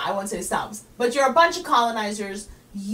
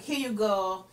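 mock French giver; 3 semitones higher than usual. French giver looks down on New York, mock French accent. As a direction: sneer in a hammed-up French accent. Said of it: "They're just like".

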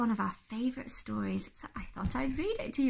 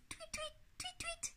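soft, very high quick chirps; birdsong; 13 dB quieter than usual. Acted. Birds sing outside the car, soft high tweeting. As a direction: cheep lightly at the top of the voice. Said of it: "went the birds".

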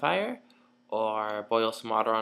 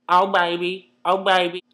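loud; 7 semitones higher, 9 dB louder than usual. Acted. Joke about shagging.

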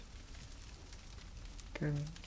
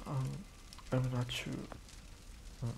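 usual speaking voice

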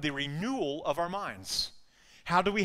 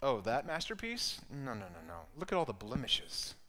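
soft, voiced near-whisper, dropping lower on the dismissal; whispering accuser; 5 semitones lower, 7 dB quieter than usual. The enemy accusing a believer in their ear, hushed insinuation. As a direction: murmur it low, like doubt planted in an ear. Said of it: "whispering in your ear".

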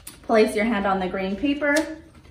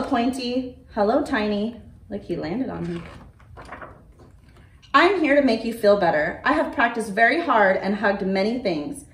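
storybook reading voice with character turns; reading to children. A narrator's reading. Librarian reading a dinosaur story aloud to children, gentle storytelling. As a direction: read it like a picture book for little ones.

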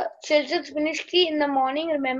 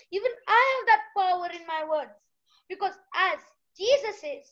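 usual speaking voice

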